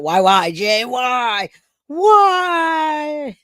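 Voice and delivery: fake-sob the why at Jay; mock wail. Mock wailing at a viewer.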